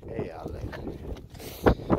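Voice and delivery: slow, hushed, almost whispered; soft whisper. Real, not acted. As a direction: breathe it softly to the dog. Handing treats to the dogs.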